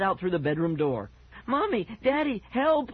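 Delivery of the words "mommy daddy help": put-on high-pitched child's cry of panic; shrieky, acted plea for rescue; screaming for help. A narrator's reading. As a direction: call for parents in terror, very high. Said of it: "I heard him scream".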